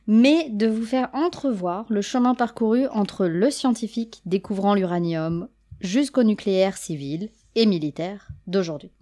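usual speaking voice

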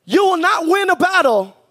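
high, full-voice preacher's shout; shouted preaching. Acted. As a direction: drive it home to the whole church, shouting.

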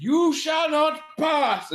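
slow, high mock Irish boom; a hammed-up fake Irish proclamation; attempted Irish. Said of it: "that's definitely not his Irish voice".

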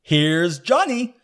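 slow, loud, high call; hammed-up movie madman shout; Jack Nicholson. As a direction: draw it out as a manic announcement. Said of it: "Jack Nicholson".

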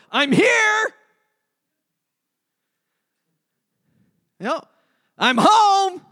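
high, loud hammed-up call of coming home; mock loud arrival. Son bursting through the door, calling out loud and high. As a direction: burst in shouting like a kid home from school. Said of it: "He doesn't open the door and go".

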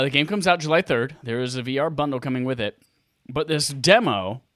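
usual speaking voice

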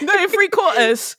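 put-on British accent. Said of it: "do an accent the whole time".

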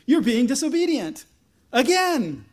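high, loud telling-off; mock scolding. Samuel scolding Israel at the coronation, hammed-up scolding. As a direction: raise the voice in exasperated rebuke.

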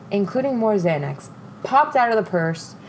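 ordinary delivery

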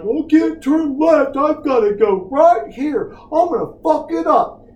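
hammed-up helpless boat captain; mock panicked boat captain. Mocking a boat captain who can't steer, high and whiny. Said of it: "you're like".

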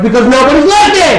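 high, exasperated retort; mock yelling. Poster explaining the all caps to a friend, mock yelling. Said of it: "Why are you yelling?".

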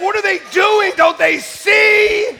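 crash-moment disbelief, shouted; panicked shout. Retold or imitated. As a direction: yell in slow-motion alarm. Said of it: "It's like".

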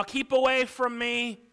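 high, loud warning to stay back; acted growl. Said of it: "only to hear him growl".

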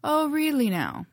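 mock surprise pitched high and loud; exclaimed. Liam to the pirate queen, exclaiming high in mock surprise. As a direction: toss it back, amused. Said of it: "Liam exclaimed".

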